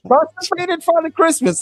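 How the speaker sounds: a hint of a strained, high Father Christmas; constipated Santa. Host repeats the jibe about his Santa, lightly strained high voice. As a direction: push it out like a blocked-up Santa, up high. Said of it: "constipated Santa Claus".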